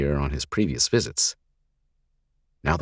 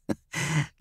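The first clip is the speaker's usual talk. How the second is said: mocking laugh; 12 semitones higher, 5 dB quieter than usual. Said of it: "The gangly leader laughed again".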